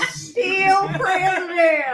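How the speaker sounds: slow drawn-out Southern drawl; Southern accent. Pretend. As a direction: drag it out in a thick Southern accent. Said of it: "Say it in a Southern accent".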